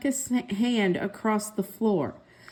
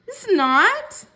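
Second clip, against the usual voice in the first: put-on much higher, louder childish retort; bickering sister; 9 semitones higher, 4 dB louder than usual. A narrator's reading. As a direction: squeal it back at him. Siblings bickering over pencil.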